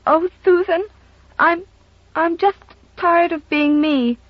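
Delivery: put-on high, plaintive little-girl voice; a tired child sighing out her trouble; weary little girl. Little girl to housekeeper, weary and plaintive. Child voice, higher than narration.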